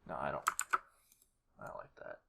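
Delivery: ordinary talk, nothing marked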